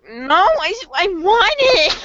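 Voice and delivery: high, drawn-out mock whine; child's tantrum. Kid dragged back inside by mom, throwing a tantrum. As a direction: wail it like a kid refusing to let go.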